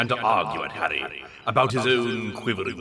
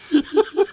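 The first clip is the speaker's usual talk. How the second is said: a sharp squeal of a laugh; high yelp of laughter; 6 dB louder than usual. Real, not acted. Reader laughing at the absurd scene, squealing. Cracks up.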